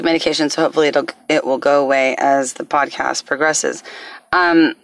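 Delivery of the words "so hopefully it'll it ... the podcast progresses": normal talking voice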